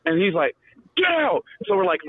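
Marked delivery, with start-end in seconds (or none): 1.0-1.4 high, barked order; drill instructor bark. Retold or imitated. Drill instructor ordering recruits out, mock bark.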